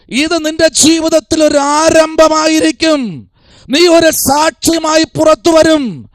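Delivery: slow, high, stretched-out preaching; prophetic proclamation. Real, not acted. Preacher promises congregation they will be a testimony, slow high proclamation. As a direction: proclaim each promise with long, ringing vowels.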